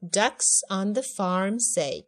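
slow, measured read-along with punchy quacks; slow lyric modelling. Shown as a demonstration. Teacher modelling duck lyrics for a child, slow rhythmic delivery. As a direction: pace it out, popping each quack.

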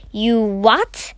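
startled question with a sharp rise at the end; can't believe your ears; disbelieving, rising query; incredulous exclamation. A narrator's reading. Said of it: "exclaimed Mister Brown".

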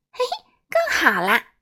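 a high little chuckle as she praises; chuckling. A narrator's reading. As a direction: giggle the praise lightly. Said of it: "轻声笑着说".

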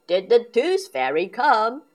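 loud, keen dad question; booming curious dad; Daddy Pig asking. A narrator's reading. Dad asks his daughter about the fairy, loud and keen. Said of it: "Daddy Pig asks".

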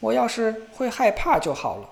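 音调高、声音大地抱怨; 不耐烦地念叨. A narrator's reading. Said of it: "不停地唠叨". (小伙子不停地高声唠叨)

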